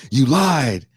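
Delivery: loud, high accusing outburst; mock accusation. Cop finding the joint on the buddy, mock accusing outburst. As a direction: retell the cop's outraged catch.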